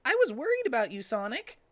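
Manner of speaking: high and fast, worried rush; Tails voice. Acted. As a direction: gush out your worry quickly. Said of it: "asked Tails".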